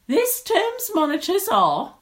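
high, loud schoolmistress announcement; shrill teacher. A narrator's reading. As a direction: announce it shrilly to the whole class. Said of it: "their teacher's shrill voice".

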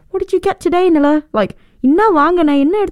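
high-pitched imitation of a brother; brother's eager question. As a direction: put on a high kid-brother voice. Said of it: "He'd be like".

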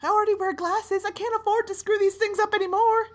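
high-pitched, worked-up protest; distressed complaint. Acted. Frostbitten passenger complains to captain about failing eyes, distressed. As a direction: wail it as a comic high complaint.

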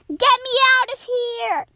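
high, loud cry for rescue; desperate call. Acted. Squid floating in the shark's tears, high urgent shout. Squid stuck in tear puddles.

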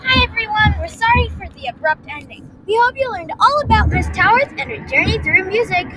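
high, bright show sign-off; cheerful outro announcement. Acted. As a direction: announce it like a host. Recorded outro to listeners.

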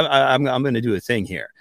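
ordinary delivery

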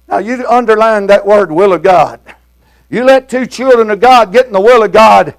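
ordinary delivery

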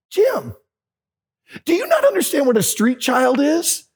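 can't believe he still doesn't get it; incredulous friend. Retold or imitated. John rebuking Jim's naivety.

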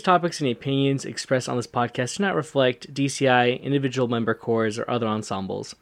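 disclaimer read. Acted. Reads podcast disclaimer intro.

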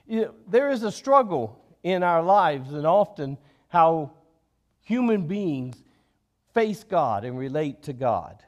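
normal talking voice

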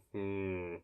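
low, soft, hesitant reply; acted unconvinced low murmur; doubtful pondering. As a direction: mull it over, then murmur assent. Doubtful reply to lead instructor.

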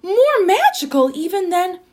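high-pitched wonder; amazed. A narrator's reading. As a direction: marvel at it, voice lifted. Narrator marvels at the magic.

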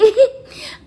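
a squealing laugh that breaks out high; real high burst of laughter. Real, not acted. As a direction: laugh out loud, high and giddy. Laughing at the story.

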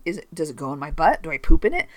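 ordinary delivery

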